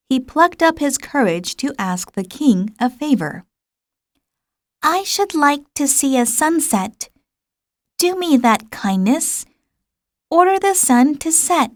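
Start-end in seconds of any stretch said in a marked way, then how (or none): 4.8-11.7 lightly voiced wistful child; little prince. A narrator's reading. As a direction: ask the king a shy favor.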